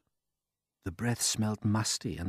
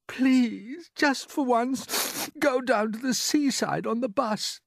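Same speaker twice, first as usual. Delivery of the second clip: put-on high, pleading child's voice; a little boy begging his aunts; small boy pleading; 5 dB louder than usual. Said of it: "James cried out".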